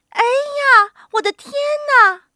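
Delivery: high, loud startled exclamation; startled little old woman; frightened cry. A narrator's reading. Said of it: "瘦小的老妇人喊起来".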